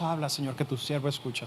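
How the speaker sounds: Habla, Señor said softly and low in volume; soft, quiet prayer. Acted. Preacher asking the congregation, in a soft quiet voice. Preacher asks why we don't say it.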